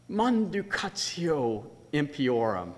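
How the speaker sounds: "Manducatio impiorum" said slowly, syllable by syllable; Latin phrase. Shown as a demonstration. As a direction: present "Manducatio impiorum" with grand Latin weight. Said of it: "amaze friends and family with a dead language".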